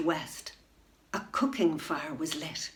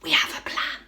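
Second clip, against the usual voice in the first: whisper. Acted. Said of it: "whispered a grey old badger".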